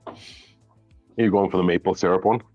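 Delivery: ordinary talk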